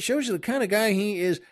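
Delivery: usual speaking voice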